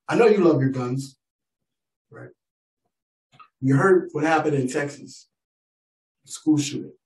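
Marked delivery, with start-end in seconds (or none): none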